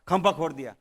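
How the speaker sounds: normal talking voice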